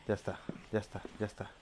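very soft relieved murmur. Real, not acted. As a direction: breathe it out quietly. Relieved after walling up. (murmullo aliviado muy bajo)

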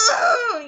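loud, high burst of "ugh"; frustrated growl. Real, not acted. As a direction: let out a sharp frustrated cry. Frustrated outburst over the game.

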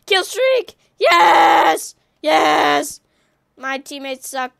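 loud triumphant yelling; cheering every kill at full volume; excited shouts. Real, not acted. Gamer celebrating his kill streak, shouting with joy.

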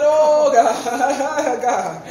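drawn-out cry breaking into a laugh; amused, overcome outburst; laughing exclamation. Retold or imitated. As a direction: groan it to God, then chuckle. Retells reaction, laughing.

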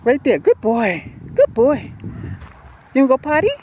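high, bright pet-talk voice. Real, not acted. Coaxing the dog outside.

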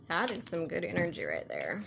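soft, slipping into a country accent. Real, not acted. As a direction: let a country twang slip out, quietly. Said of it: "I had a country accent all of a sudden".

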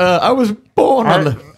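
high, drawn-out Bane parody; mock the Bane speech. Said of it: "Do your Bane voice".

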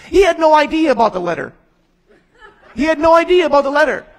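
loud, high, fast, laughing; laughing disbelief. Real, not acted. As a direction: blurt it through laughter. Laughing at the unlikely letter.

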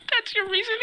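laughing disbelief. Real, not acted. Mocking friend's subscribe reason.

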